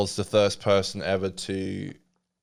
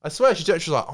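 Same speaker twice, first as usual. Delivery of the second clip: ordinary talk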